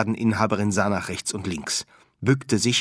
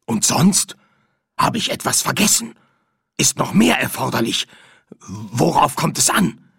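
startled; 5 semitones higher, 5 dB louder than usual. Acted. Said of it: "Der Kunde wirkte erschrocken".

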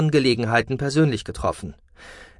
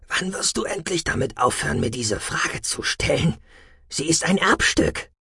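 leicht höher, locker neckend; Freundstimme; 6 semitones higher than usual. Acted. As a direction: ein Kollege, der lässig kontert. Freund Damalan gesprochen. (leicht verstellte, lockere Freundstimme)

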